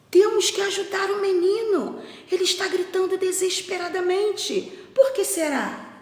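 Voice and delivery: high, urgent storybook farmer; worried voice pitched well up; alarmed concern. Acted. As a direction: urge your wife to come and help. Farmer alarmed by boy's screams.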